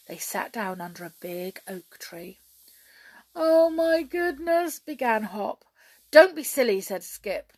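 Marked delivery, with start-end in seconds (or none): 3.3-4.8 high, slow, loud moan; miserable wail. A narrator's reading. Hop unhappy under the oak, wailing. 6.1-6.8 high, loud, impatient reproof; cross scolding. A narrator's reading. Skip silencing Hop, cross.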